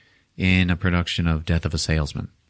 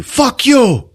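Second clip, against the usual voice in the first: much higher, louder yell; angry shout; 6 dB louder than usual. Retold or imitated. Two men fighting on the ground, acted angry shout. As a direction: imitate a fighter escalating to a yell.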